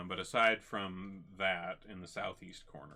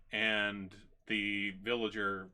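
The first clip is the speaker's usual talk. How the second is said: ordinary delivery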